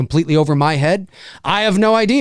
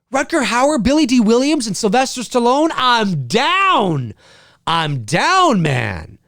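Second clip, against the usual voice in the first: thrilled; 5 semitones higher, slower than usual. Real, not acted. Thrilled repeating the cast.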